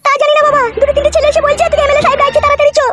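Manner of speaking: high-pitched daughter-in-law, fretful. Acted. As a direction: play the worried daughter-in-law reporting her husband's arrest.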